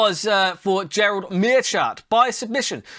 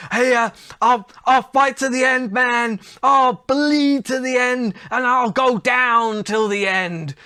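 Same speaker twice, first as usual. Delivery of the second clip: slow, louder, slurred high-on-drugs voice; imitating stoned De La Hoya; 3 semitones higher, 3 dB louder, slower than usual. Host imitating Oscar De La Hoya rambling high on commentary. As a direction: drawl it out like you're off your head. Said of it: "he literally just goes".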